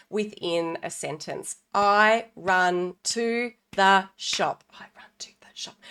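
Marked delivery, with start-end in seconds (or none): 1.7-4.6 slow, each word set apart with a gap. Shown as a demonstration. Teacher to parents, slow word-by-word demo. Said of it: "Five words within that sentence". 4.7-5.8 quick soft whisper of "I run to the shop". Shown as a demonstration. Teacher to parents, quick whispered run-through. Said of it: "Five words within that sentence".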